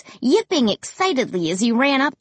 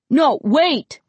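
higher, urgent call to stop; 5 semitones higher, 4 dB louder than usual. A narrator's reading. Dad calls after his son heading to the car, calling out. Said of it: "Dad called".

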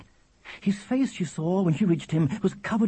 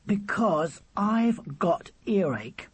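slow, higher-pitched complaint; pained exasperation; 6 semitones higher, much slower than usual. Acted. William explaining earache to noisy Charlie, exasperated. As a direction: spell it out slowly, word by word.

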